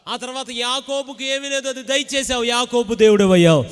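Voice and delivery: loud, climactic preaching over music; preaching at full force. Acted. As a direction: build to a triumphant shout. (సంగీతంపై బిగ్గరగా ఉద్వేగ ప్రసంగం)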